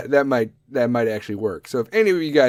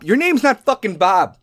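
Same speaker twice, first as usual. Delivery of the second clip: loud, high-pitched comic outrage; indignant rant; 8 semitones higher, 7 dB louder than usual. Acted. Caller disputing the agent's name, indignant and loud. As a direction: fire back, exasperated and loud. Ranting back at call agent.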